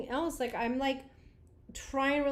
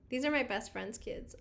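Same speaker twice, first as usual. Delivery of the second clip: normal talking voice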